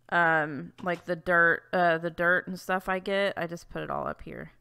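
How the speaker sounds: ordinary talk, nothing marked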